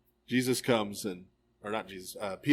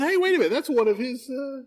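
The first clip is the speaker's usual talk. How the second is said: high, raised voice calling him out; a bystander pointing a finger, pitched well up; accusing onlooker; 11 semitones higher than usual. Retold or imitated. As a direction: onlooker catching Peter out. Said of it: "Somebody else says".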